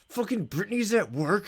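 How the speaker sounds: high-pitched, irritated inner voice; pissed off. Retold or imitated. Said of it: "I just got pissed at her".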